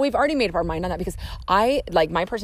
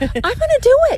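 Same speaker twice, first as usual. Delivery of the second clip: excited; 8 dB louder than usual. Real, not acted. Vowing to stick to budget.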